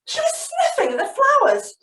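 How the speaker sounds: high, laughing read of the line; playful high giggle; giggly sniffing. Acted. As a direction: giggle as you sniff the flowers.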